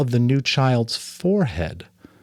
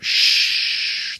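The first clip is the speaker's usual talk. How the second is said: shushing. A narrator's reading. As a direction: hush him gently. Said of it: "the giver said".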